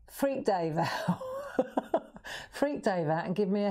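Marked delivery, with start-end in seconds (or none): 1.2-2.5 high squeaky laugh. Real, not acted. Laughs after mock scream.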